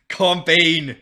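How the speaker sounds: loud, laughing exasperation; exasperated laughing. Real, not acted. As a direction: laugh and plead at once.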